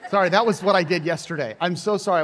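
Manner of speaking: ordinary talk, nothing marked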